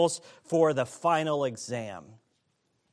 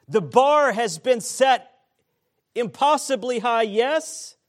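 loud, high preaching; 8 semitones higher, 7 dB louder than usual. Acted. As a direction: preach it with full force. Emphatic preaching peak.

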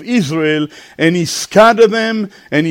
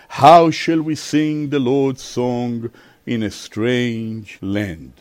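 very low, slow, fading question; low exiles' lament, dying away; sorrowful lament; 9 semitones lower, 5 dB quieter, slower than usual. A narrator's reading. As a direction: sink down in mournful resignation. Voicing the exiles' sorrowful answer.

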